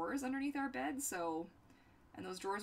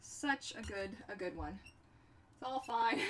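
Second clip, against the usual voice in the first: usual speaking voice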